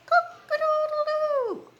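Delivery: high, loud, drawn-out cock-a-doodle-doo; rooster crow. Acted. Teacher crowing as the rooster to the class.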